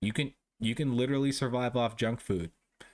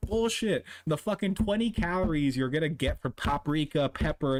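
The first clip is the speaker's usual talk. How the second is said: ordinary talk, nothing marked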